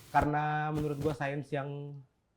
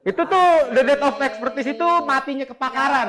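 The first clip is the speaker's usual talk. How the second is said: laughing through the words; 11 semitones higher, 9 dB louder than usual. Real, not acted. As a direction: keep laughing as you explain. Both laughing.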